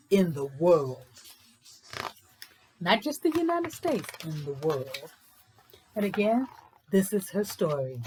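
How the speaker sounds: read-aloud story narration. A narrator's reading.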